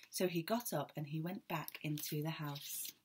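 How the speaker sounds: ordinary delivery, nothing marked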